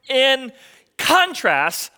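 a pause, then "contrast" said with emphasis; stress on contrast. Real, not acted. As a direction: set "contrast" apart after a beat and punch it. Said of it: "why I stress the word contrast".

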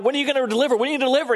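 high, pleading cry. Retold or imitated. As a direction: beg God for an answer. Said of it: "people are crying out".

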